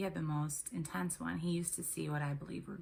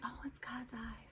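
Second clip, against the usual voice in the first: put-on hushed, awed little-kid whisper; childlike whisper; 6 semitones higher, 10 dB quieter than usual. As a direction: breathe it like a wondering child. Retells her childhood thought.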